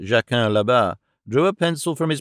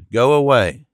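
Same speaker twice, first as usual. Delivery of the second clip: louder, blunt final order; firm dismissal; 5 dB louder than usual. Acted. Landlord throws the traveller out, loud firm order. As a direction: slam the door on him with your voice.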